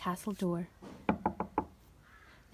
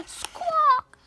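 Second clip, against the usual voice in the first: loud, high bird cry; hen squawk; 7 dB louder than usual. A narrator's reading. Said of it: "It was a hen".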